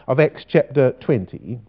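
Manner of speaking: usual speaking voice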